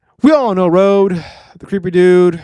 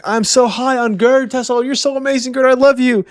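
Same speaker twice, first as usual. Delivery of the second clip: lovestruck gushing; 8 semitones higher than usual. Retold or imitated. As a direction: swoon over her like a starstruck fanboy. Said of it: "He's like".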